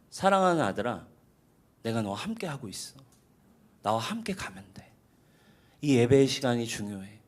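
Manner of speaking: soft, low, gentle comfort; God comforting the listener, hushed and tender; tender reassurance. Retold or imitated. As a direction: soothe a worried child. God's tender voice continues.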